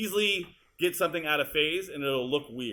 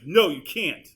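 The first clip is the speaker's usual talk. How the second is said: emphatic mock refusal, louder on the no; imitating actress's line; 6 dB louder, slower than usual. Editor voices the actress's line, emphatic. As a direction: act out a firm retort. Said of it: "where she goes".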